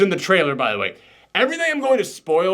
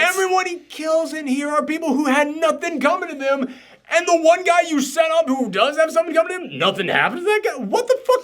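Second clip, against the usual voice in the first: much higher, rising outrage; exasperated rant; 10 semitones higher than usual. Real, not acted. Reviewer ranting to his cohost, exasperated high outburst. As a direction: vent like the film wronged you. Heated rant about the movie.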